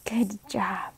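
soft whispered good job; whispered praise. Real, not acted. Praising child during reading.

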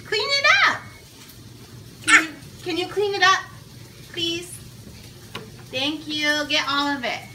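firm but sing-song parent tone. Real, not acted. Mom scolding toddler at dinner.

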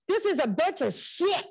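retold angry outburst. Daughter standing up to counselor in front of mom, indignant. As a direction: retell blurting out a curse in protest.

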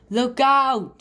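loud, high alarmed call; shouted warning. Acted. Frog warns of the witch.

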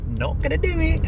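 very high, softened squeak; happy emotional. Real, not acted. Traveller refusing to give in at the seafront, happy emotional. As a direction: let it go squeaky, holding emotion back. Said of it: "I'm emotional".

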